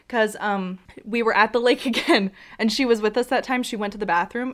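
usual speaking voice

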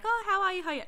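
high, gushing hello; sweet nurses' greeting. Retold or imitated. Clinic nurses warmly welcoming a nervous patient, high cooing greeting. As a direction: coo it like kind clinic nurses.

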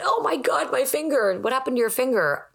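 high mock alarm over a finger; concerned partner acting alarmed about a hurt finger; alarmed question. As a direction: gasp it out like someone who just spotted an injury.

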